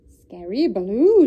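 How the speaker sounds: loud, slow quacky duck cry. A narrator's reading. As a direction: stretch it like a showy duck. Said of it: "she cried".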